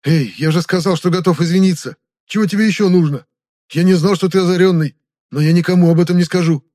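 high, loud, panicky pleading; desperate bargaining. Acted. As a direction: bargain urgently for your life. Captive begs captor to relent.

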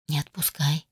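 soft smiling whisper. Acted. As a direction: whisper it with a smile. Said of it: "прошептала с улыбкой".

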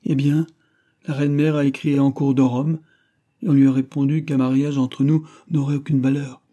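a lover defending himself; impassioned young king. A narrator's reading. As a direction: press each point on her.

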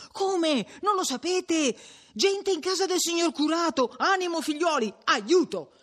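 high, urgent cry for help; rally the neighbours in alarm; raising the alarm. A narrator's reading. Ambrogio rousing the villagers to help the curate, raising the alarm.

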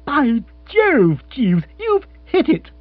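high, slow delighted exclaim; excited. Acted. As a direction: cry out in triumph. Lord delighted.

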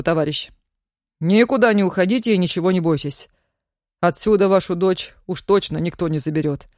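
quick, official reassuring tone; brisk young militiaman. Acted. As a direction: hurry but calm him down.

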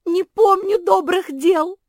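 high, loud panicked wail. A narrator's reading. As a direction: blurt it in panic. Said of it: "запаниковала Куки".

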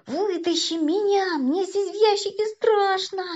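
высокое протяжное нытьё от страха; жалобно проси о спасении из ящика; испуганная мольба. A narrator's reading. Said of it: "взмолился Вовка". (испуганно молить)

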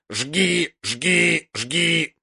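croaked bird cry, the vowel stretched upward; raven's caw. A narrator's reading. Raven urging Jon with the lamp, cawing. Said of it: "каркнул ворон".

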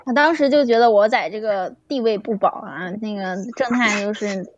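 ordinary delivery

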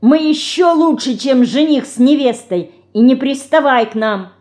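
high-pitched, heated outburst; furious. A narrator's reading. Dinka orders her sister to back off, furious. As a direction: argue back in a rage. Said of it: "в бешенстве закричала Динка".

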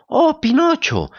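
high, slow, whining fox cry; whimpering cry. A narrator's reading. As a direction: wail it out pitifully. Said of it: "gritó la zorra lloriqueando".

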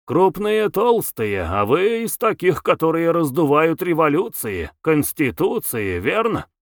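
ordinary delivery, nothing marked

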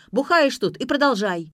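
loud high accusing shout; furious shouted charge; yelling. A narrator's reading. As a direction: keep yelling at him. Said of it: "заорала блондинка".

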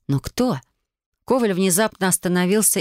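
ordinary delivery, nothing marked

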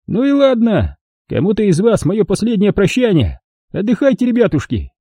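put-on high, cheerful cruel old man. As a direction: play a folksy sadistic old prospector. Old man says farewell.

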